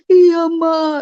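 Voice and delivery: high, loud, drawn-out confession; retold wailing yes; imitated tearful admission. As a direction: mimic a crying husband owning up. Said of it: "dia nangis".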